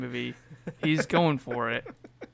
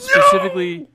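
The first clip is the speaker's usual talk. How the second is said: excited; 13 dB louder than usual. Real, not acted. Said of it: "excited pick".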